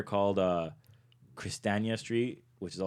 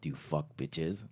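low, slow, soft put-on voice; pitched-down deadpan impersonation; mock stranger voice; 4 semitones lower, 7 dB quieter, slower than usual. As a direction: imitate an odd stranger, drawn out. Same retold stranger's put-on voice.

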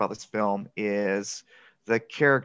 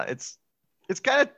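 ordinary talk

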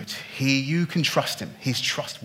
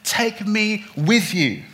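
slow, higher pleading plea, lightly acted; imitated begging; 7 semitones higher, 5 dB louder, slower than usual. Freed man asking Jesus to take him along, slow higher plea. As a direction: beg him slowly to take you along. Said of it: "He was saying".

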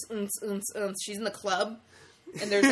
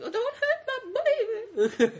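much higher, laughing hammed-up plea; mock panicked dad; 14 semitones higher than usual. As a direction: play a frantic father, half laughing. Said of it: "he's like".

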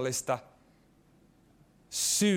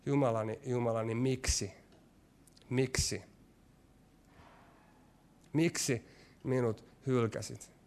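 softer, lower, broken repetition of the cry; hushed anguished plea; 3 semitones lower, 6 dB quieter than usual. Retold or imitated. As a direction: ask it quietly, as if worn out. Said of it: "kysyy ja esittää saman kysymyksen". (hiljainen, murtunut huuto)

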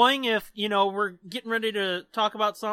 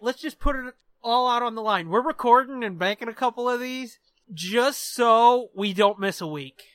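ordinary delivery

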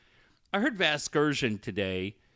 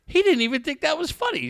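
higher mock-indignant woman's voice; imitating his friend; 12 semitones higher, 3 dB louder than usual. Host retelling his friend's complaint, higher mock-indignant voice. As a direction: raise your pitch and play her offended. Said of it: "She goes".

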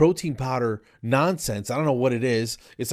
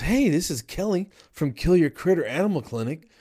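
imitated chirpy receptionist, higher pitch; mock-cheery phone greeting; enthusiastic; 6 semitones higher than usual. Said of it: "all enthusiastic".

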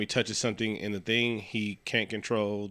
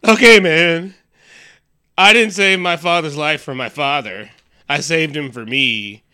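loud, hammed-up dramatic confession; Nick; 5 semitones higher, 11 dB louder than usual. Nick confessing about his father, loud mock melodrama. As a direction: burst out, then confess with mock anguish. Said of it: "And Nick was like".

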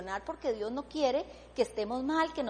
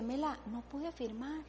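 retold crying; 9 dB quieter than usual. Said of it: "yo lloraba y lloraba".

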